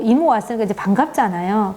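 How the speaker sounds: normal talking voice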